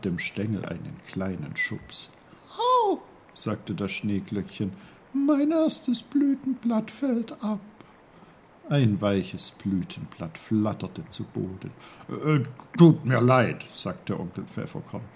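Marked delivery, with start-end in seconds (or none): 2.6-3.0 very high, loud little yelp; squeaked cry of pain; ouch. A narrator's reading. Nudged snowdrop cries out. 5.1-7.6 very high, tiny flower voice; reply as a dainty talking flower; squeaky-high small character; little snowdrop. A narrator's reading. Snowdrop loses petal. 12.1-13.7 loud, halting apology; put-on uncle voice, falling at the end; Onkel Pfefferkorn, sorry. A narrator's reading.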